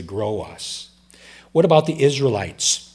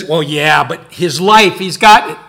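loud, taunting challenge as Satan; 3 semitones higher, 12 dB louder than usual. Retold or imitated. Preacher voicing Satan's taunt to God, loud and jeering. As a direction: push it louder, jeering at God's claim. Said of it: "Then Satan comes to him and says".

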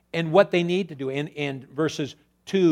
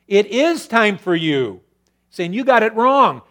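raised, higher voice, rebuking; God's rebuke; 5 semitones higher, 7 dB louder than usual. Acted. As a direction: thunder it as God scolding the people.

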